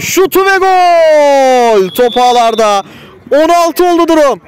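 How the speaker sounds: stretched-out high goal scream; screaming football commentator. Real, not acted. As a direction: hold the goal call long and high. Goal call in live commentary.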